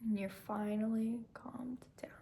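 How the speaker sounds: whisper. Acted. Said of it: "whispered close of meditation".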